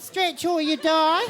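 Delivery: high, slow, drawn-out mock exercise coach; hammed-up fitness nut. Passengers at the plane's front, mock coaching voice. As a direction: preach stretching like a zealot. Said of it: "They're like".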